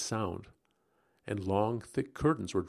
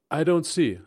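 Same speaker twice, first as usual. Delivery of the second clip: higher and louder, sharp protest; sharply; 7 semitones higher, 7 dB louder than usual. A narrator's reading. Narrator confronting Rebecca about the doll, sharp and higher. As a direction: snap back, uneasy and accusing. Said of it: "I spoke sharply".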